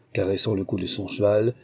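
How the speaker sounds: plain reading voice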